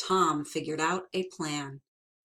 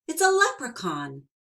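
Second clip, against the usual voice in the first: excited discovery; 7 semitones higher, 5 dB louder than usual. Acted. Tom spots the leprechaun.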